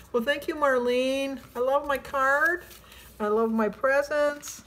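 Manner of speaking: ordinary talk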